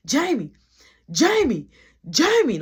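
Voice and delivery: loud, rising calls through a door; calling out. Retold or imitated. Tamika banging on the door calling for Jamie. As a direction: call her name over and over.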